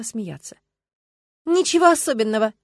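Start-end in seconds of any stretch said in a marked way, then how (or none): 1.5-2.5 louder, higher curt dismissal; curt cut-off. A narrator's reading. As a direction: snap it shut, end the topic. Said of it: "отрезала она".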